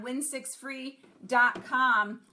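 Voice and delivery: each word set apart, slowly; slow and clear so viewers can note it; slow, spelled-out web address. Shown as a demonstration. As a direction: dictate the address for writing down. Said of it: "Write it down".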